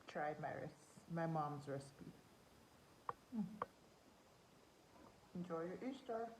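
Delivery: talking through a mouthful; chewing while talking; mouth full. Real, not acted. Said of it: "Sorry for talking with my mouth full".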